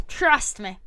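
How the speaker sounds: ordinary talk, nothing marked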